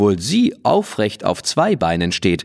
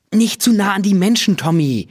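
hohe, mahnende Kinderstimme; Mädchenstimme; 11 semitones higher than usual. A narrator's reading. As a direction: ermahne das Pony wie ein kleines Mädchen. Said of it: "sagt Lene". (hohe, besorgte Mädchenstimme)

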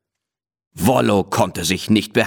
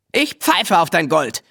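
much higher, cheeky defiant call; 8 semitones higher than usual. A narrator's reading. Willi refusing the sheikh's gold in a much higher defiant call. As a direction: toss it off like a boy who wants no reward. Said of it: "rief der wilde Willi".

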